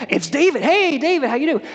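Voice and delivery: bright, high welcome to a new friend; cheery greeting. Retold or imitated. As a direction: greet David like an old buddy. Saul warmly greets young David.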